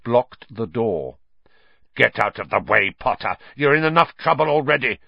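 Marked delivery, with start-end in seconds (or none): 1.9-5.0 louder snarling order; snarled. A narrator's reading. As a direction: snarl at him. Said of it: "snarled Snape".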